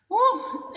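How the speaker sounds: high "woo" whoop; short, high-pitched exhaled whoop; letting off steam. Real, not acted. Parent moving on from a tough topic, letting off steam.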